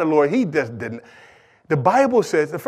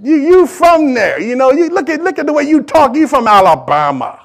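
high-pitched, insistent accusation; accusing bystanders; 8 semitones higher, 4 dB louder than usual. Acted. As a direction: act out a crowd pointing out a country accent. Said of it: "They said".

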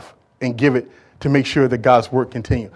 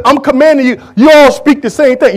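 Paul commanding; 9 semitones higher, 10 dB louder than usual. Acted. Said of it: "He said".

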